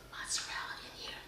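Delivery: trailing whisper. Real, not acted. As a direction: let it fade into a whisper. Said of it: "Every time I start whispering".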